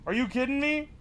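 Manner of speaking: normal talking voice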